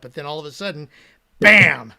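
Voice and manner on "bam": loud bam. Retold or imitated. Sudden chat flood exclaimed.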